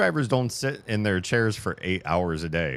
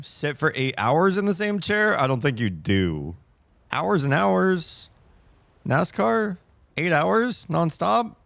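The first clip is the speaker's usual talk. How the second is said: incredulous; 10 semitones higher, 4 dB louder than usual. Real, not acted. Incredulous at nascar claim.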